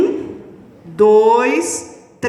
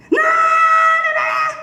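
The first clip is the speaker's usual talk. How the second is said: put-on much higher, drawn-out child's shouted refusal; a child's loud stubborn no, slow and high; defiant child; 3 dB louder, slower than usual. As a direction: yell no like a stubborn kid. Defiant child answering mother.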